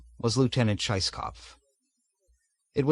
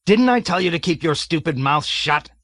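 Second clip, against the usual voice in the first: loud, higher barked rebuke; angry shout; 5 semitones higher, 4 dB louder than usual. A narrator's reading. Colonel shouts down Major Metcalf angrily. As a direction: tear into him.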